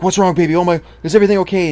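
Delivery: playing the alarmed dad-to-be; mock worried husband. Husband rushing to his pregnant wife in mock alarm. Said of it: "I'm just like".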